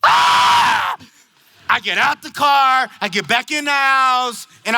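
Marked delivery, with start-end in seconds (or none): 0.0-1.0 very high, loud mock scream; hammed-up shriek of frustration; comic piercing yell; exasperated scream. Pastor's exaggerated exasperated outburst.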